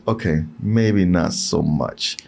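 low, slow letdown; a sagging, disappointed take; deflated. Retold or imitated. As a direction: drop it low and slow, let the air out. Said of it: "it was like".

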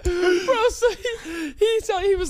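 high-pitched, breaking into laughter; laughing. Real, not acted. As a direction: squeak the words through laughter. Telling a funny grocery story.

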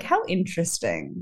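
low, soft, loaded musing; quiet, pointed remark to oneself; malicious-sounding. Retold or imitated. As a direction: drop it quietly, as if plotting. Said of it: "that sounds so malicious".

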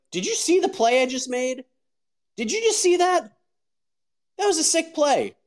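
high, excited, cheeky showing off; playful bragging. Retold or imitated. To the angry giant guy, hamming up his own play, high and excited. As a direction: brag high to break the tension. Defusing a giant opponent mid-scuffle.

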